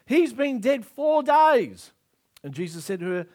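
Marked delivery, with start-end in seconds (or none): 0.1-1.9 loud, high, drawn-out objection; hammed-up protest at a bad idea; dismayed protest. Voices Martha's protest at the tomb.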